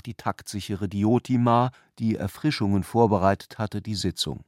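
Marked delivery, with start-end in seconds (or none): none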